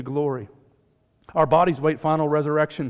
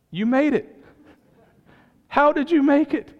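much higher and louder, building wonder; amazed question; 12 semitones higher, 4 dB louder than usual. Acted. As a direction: marvel at him, rising higher each time. Marvelling at the saved thief.

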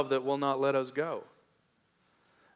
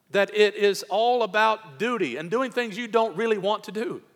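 higher, louder preaching climb; full-voiced pulpit plea; impassioned preaching; 7 semitones higher, 4 dB louder than usual. Real, not acted. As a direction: press the appeal home from the pulpit.

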